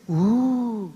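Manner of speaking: drawn-out imitated "oooh" of amazement; mock crowd "oooh". Preacher mimicking people wowed by miracles, mock amazed ooh. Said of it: "no fue que la gente diga".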